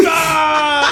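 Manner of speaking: high, loud straining yell; effort scream. Retold or imitated. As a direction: heave and roar while shoving. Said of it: "gets up under the guy and goes".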